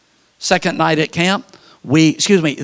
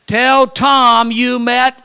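put-on slow old man's message; 8 semitones higher, 5 dB louder, much slower than usual.

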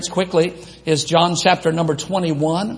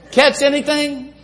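casual fisherman greeting; 8 semitones higher than usual. Retold or imitated. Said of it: "what do you say to someone that's fishing".

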